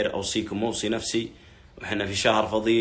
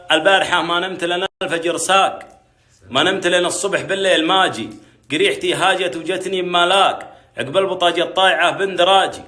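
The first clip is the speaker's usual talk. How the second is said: loud, high chanted verse; poem recitation; 7 semitones higher, 6 dB louder than usual. Acted. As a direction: declaim it like a Nabati poet. Recites his reply poem. (إلقاء شعر نبطي بصوت عال)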